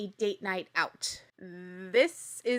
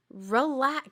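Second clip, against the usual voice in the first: ordinary delivery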